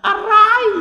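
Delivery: a sharp, high, loud yelp of pain; sudden pained ouch. Pretend. Adam yelping at God mid-surgery, sharp and loud. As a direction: cry out as if cut open while awake.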